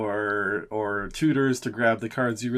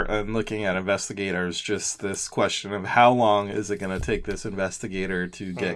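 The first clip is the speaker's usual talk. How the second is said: normal talking voice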